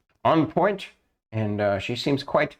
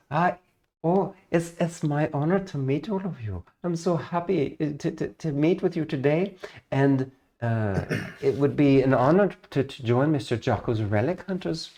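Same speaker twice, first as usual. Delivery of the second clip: put-on higher, sweet, reserved young elf; elf ranger voice; 7 semitones higher than usual. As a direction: speak as a sweet shy elven ranger, a little higher.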